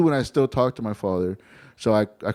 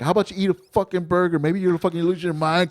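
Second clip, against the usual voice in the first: raised-pitch retold rant; spazzing out; 7 semitones higher than usual. Houseguest retelling how he spazzed out on a drunk tourmate, higher-pitched angry rant. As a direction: let loose a string of insults in a higher voice. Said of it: "I spazzed out".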